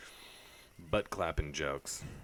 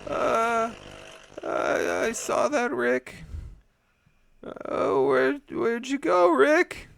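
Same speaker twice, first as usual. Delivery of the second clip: Morty impression; 9 dB louder, slower than usual. Morty voice answering Rick.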